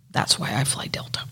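sly hushed aside; conspiratorial whisper. Real, not acted. Admitting the smuggled beer with a sly whisper. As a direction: whisper it like an insider joke.

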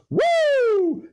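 mock announcer's high yelling woo; arena announcer woo. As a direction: imitate the arena hype man's woo. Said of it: "and then he goes".

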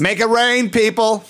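high hype cheer; make it rain. Acted. Host hyping the crowd, excited cheer. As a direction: whoop it up for the dancers.

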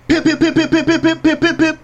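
high rapid pip pip shots; imitated gunfire. Fight in a friend's club, high rapid gunshot sounds. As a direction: fire them off quick and high like a pistol.

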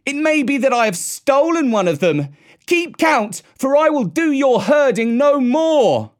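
high, declaimed defiant speech; scornful shout. A narrator's reading. Mowgli bids the villagers farewell, shouting scornfully. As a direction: proclaim it loudly with contempt. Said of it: "shouted Mowgli scornfully".

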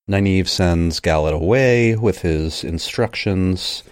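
ordinary talk, nothing marked

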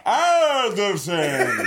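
Anderson blurted out high, loud and slow through a laugh. Retold or imitated. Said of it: "I said it twice".

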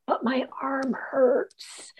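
high, soft, slow mock whine; hammed-up pitiful complaint; whining excuse. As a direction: imitate a feeble husband dragging out an excuse. Husband making feeble excuse to judge.

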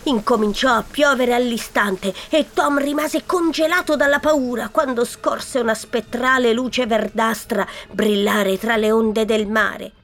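usual speaking voice

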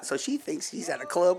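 normal talking voice

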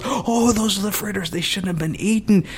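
lightly voiced stagehand; a hint of a fussy stagehand. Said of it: "the guy goes".

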